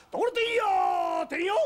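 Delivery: 遠くまで届く、長く引き伸ばした大声の売り声; 路地に響かせる物売りの叫び; ところてん売りの呼び声. Acted. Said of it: "大きな声だな". (物売りが大声で「ところてんよー」と長く伸ばす)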